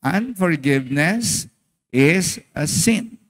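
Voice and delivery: slow, word by word, leading the congregation; slow unison reading. Shown as a demonstration. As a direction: read it out together, very slowly. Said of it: "Sabay-sabay".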